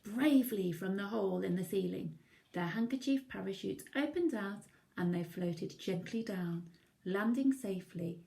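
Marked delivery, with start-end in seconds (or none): none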